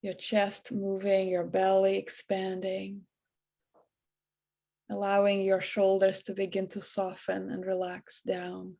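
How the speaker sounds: soft, hushed meditation voice with long pauses; guided meditation. Acted. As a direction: ease them into relaxation, quietly and evenly. Leads a guided breathing meditation.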